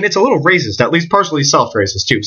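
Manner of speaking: usual speaking voice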